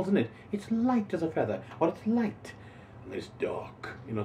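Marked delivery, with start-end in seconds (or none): 0.5-2.5 higher, floating delivery of light; airy light. Shown as a demonstration. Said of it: "The word light says it all". 3.0-4.2 whispered, heavy dark; hushed, oppressive dark. Shown as a demonstration. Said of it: "the dark oppressiveness of that word".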